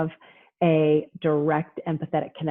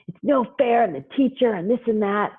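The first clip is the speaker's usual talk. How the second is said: higher-pitched mock kid whine; frustrated child complaining; 5 semitones higher than usual. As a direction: imitate a kid protesting unfair homework. Child complaining about assignment.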